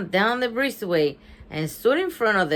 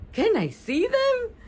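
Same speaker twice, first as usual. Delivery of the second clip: small high nervous question. A narrator's reading. Nervous first grader asking Bean to see the bones, nervous. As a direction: ask timidly in a high voice. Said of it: "He looked at her nervously".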